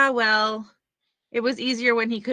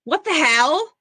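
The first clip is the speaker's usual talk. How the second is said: startled; 7 semitones higher, 7 dB louder, slower than usual. Acted. Finds the rope in drawer.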